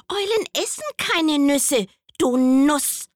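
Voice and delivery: hoch und laut, neckend; frecher Maulwurf. Acted. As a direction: Maulwurf zieht die Eule auf. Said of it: "sagte der Maulwurf". (hohe, laute, neckende Maulwurfstimme)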